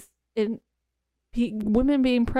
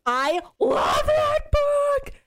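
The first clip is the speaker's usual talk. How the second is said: gushing; much slower than usual. Real, not acted. Gushing over favorite book.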